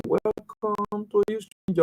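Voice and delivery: high, slow, drawn-out imitation of a classroom welcome; school welcome greeting. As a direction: imitate pupils greeting a visitor, stretched out high. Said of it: "those days when we were in school".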